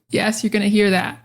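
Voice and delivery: ordinary talk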